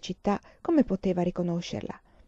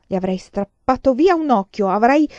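louder, higher bold boast; fierce child boast; 5 semitones higher, 8 dB louder than usual. Acted. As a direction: brag like a little warrior. Child boasting of fighting bandits. (vanto fiero di bambina, più forte)

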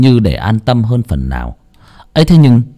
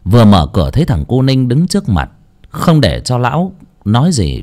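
ordinary delivery

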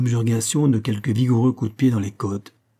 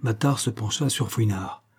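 ordinary delivery, nothing marked

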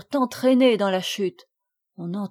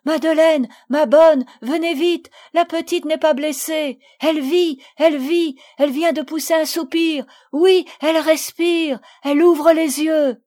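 much higher and louder, joyful calling out; cry of joy; 7 semitones higher, 8 dB louder than usual. A narrator's reading. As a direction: shout the good news, overjoyed. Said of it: "ne peut retenir un cri de joie". (cri de joie, beaucoup plus aigu et plus fort)

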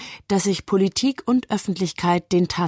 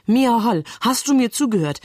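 higher, sharply insistent challenge; gereizter Streit; 5 semitones higher than usual. Acted. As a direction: demand attention in a heated sibling quarrel. Geschwister streiten über die Polizei. (gereizt und eindringlich nachhaken)

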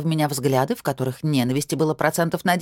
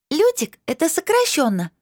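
put-on high child voice explaining; a small girl proudly explaining; little girl voice; 12 semitones higher than usual.

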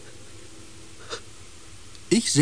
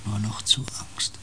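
fearful whisper; 4 semitones lower, 7 dB quieter than usual. Acted. Osden tells the crew how the fear began, whispering. Recounting bleeding in the forest, whispering.

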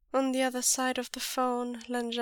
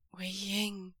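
pleading; 4 dB quieter than usual. Acted. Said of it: "It sounds like a plea".

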